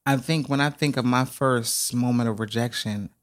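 ordinary delivery

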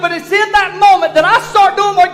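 rising, loud preacher's build; preaching at full force. Acted. Pastor preaching to his congregation, building loud. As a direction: whip the congregation up with joy.

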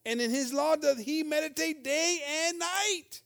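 slow, solemn reading of the verse; slow, elevated Bible recitation; scripture reading. Acted. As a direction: lift it up like holy writ, slowly. Reads psalm verse aloud.

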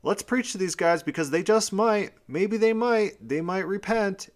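high, eager voice of God. Retold or imitated. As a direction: lightly play God hoping they'll listen. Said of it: "God's saying".

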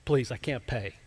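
soft, quiet begging; a mild plea from a servant in debt; pleading. Retold or imitated. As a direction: plead for mercy under your breath. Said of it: "asks him for mercy".

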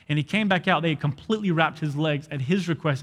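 normal talking voice

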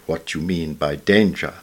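ordinary talk

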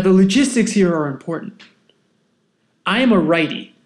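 plain reading voice

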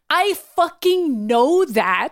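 mock annoyed, stretching out each word. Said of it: "She's, like, annoyed".

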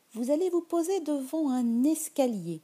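plain reading voice

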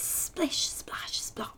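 hushed sound-effect whisper; whispered splish splash. A narrator's reading. Reading to the kids, whispered noises. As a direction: breathe the noises out softly.

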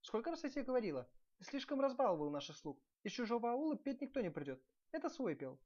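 high-pitched wifely scolding; angry princess. A narrator's reading. Princess scolding husband for spoiling servants, angry. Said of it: "рассердилась княгиня".

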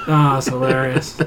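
ordinary talk, nothing marked

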